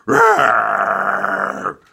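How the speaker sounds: loud, drawn-out mock bear roar; big growling animal roar. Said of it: "And the bear went".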